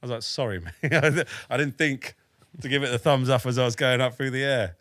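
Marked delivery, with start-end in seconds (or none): 0.2-3.3 laughing apology. Retold or imitated. Soldier jokes to his friend after being blown up, laughing. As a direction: chuckle through it, higher and louder.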